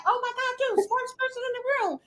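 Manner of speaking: high, quick gushing praise; mock admiring crowd. Onlookers admiring the know-it-all, mock gushing. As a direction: gush like an impressed onlooker. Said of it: "everybody looking like".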